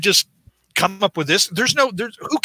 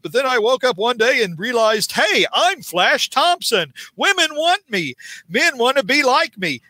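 strut through the brag, pitched way up; cocky jock boast; 6 semitones higher than usual. Retold or imitated. Flash brags to Peter, high and smug.

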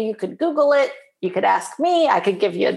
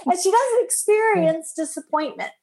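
higher, fast and laughing supermom voice; hammed-up rescuing mom, laughing through it; mock supermom; 7 semitones higher than usual. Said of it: "you're like".

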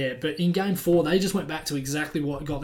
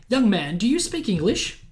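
put-on English accent; 6 semitones higher, 3 dB louder than usual. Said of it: "she has a moderate English accent".

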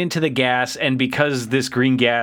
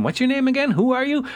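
high mock question; 10 semitones higher than usual. Characters asking a name.

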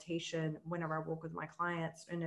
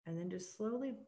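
guided meditation; 5 dB quieter than usual. Acted. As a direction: ease her into calm breathing, gently.

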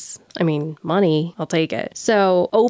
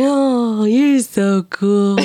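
put-on sweet drunk; much slower than usual. Drunk cashier sweet-talking the manager.

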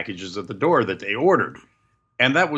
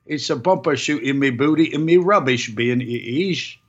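hammed-up, higher-pitched British accent; badly faked cheery British voice; fake British accent; 4 semitones higher, slower than usual. Podcaster auditioning a British accent for co-host, hammed-up fake British. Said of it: "do a fake British accent".

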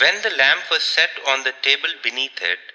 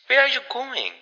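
higher, strangely breathless question; 9 semitones higher than usual. A narrator's reading. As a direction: ask it as if a little short of breath. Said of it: "in a strange, breathless voice".

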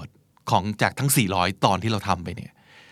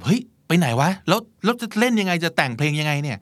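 higher, startled "where did it go" voice; retold dismay; 7 semitones higher than usual. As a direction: play the musician's panic at the lost string, lightly. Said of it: "แล้วเขาก็รู้สึกแบบ". (นักดนตรีตกใจที่สายหาย เสียงสูงขึ้นแบบเล่าเลียน)

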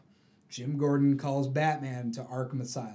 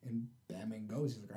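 ordinary talk

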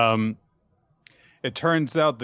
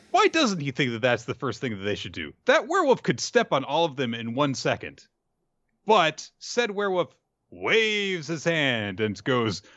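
ordinary talk, nothing marked

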